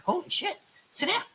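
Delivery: high, startled exclamation; shocked alarm. Retold or imitated. Shocked teacher tells robbed student to sit. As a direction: gasp it out in alarm.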